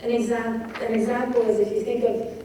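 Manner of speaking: normal talking voice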